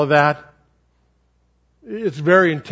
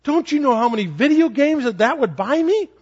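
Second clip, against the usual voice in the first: put-on raised, eager little boy's voice; child's voice; 8 semitones higher than usual. Son asking his dad about video games, high childlike voice. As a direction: pitch it up like a hopeful seven-year-old. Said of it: "He said".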